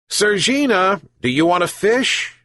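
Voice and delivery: high, coaxing call. A narrator's reading. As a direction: tempt the cat down. Old man coaxing the cat.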